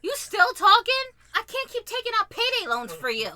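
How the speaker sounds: high, loud mock disgust; imitated scornful girlfriend. Girlfriend to broke dude, mock scorn. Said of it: "main way to play dudes".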